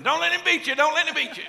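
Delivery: high, loud, urgent cheering; wife's excited cry. Retold or imitated. Wife cheering on her husband in a match, high excited shouting.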